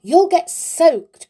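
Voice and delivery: high, loud, drawn-out warning; alarmed slow cry. A narrator's reading. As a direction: call out a warning, stretching the words. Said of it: "cried the bear".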